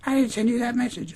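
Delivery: very high falsetto protest; mock denying woman. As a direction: imitate her insisting in falsetto. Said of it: "and she's just like".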